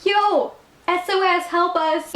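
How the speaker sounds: high, drawn-out call for help; mock distress call. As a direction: call out a playful SOS. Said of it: "where they can be like".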